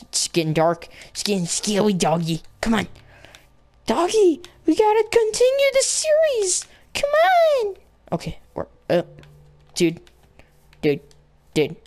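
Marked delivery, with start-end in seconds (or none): 3.9-7.7 squealed, very high whine to a dog; high-pitched pleading. Pretend. Player urging doggy along, squeaky pleading. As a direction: beg playfully, climbing higher to the end.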